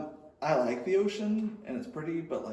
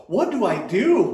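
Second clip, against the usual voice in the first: panicked; 7 semitones higher, 9 dB louder, slower than usual. Retold or imitated. Panicked youth pastor.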